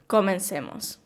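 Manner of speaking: ordinary delivery, nothing marked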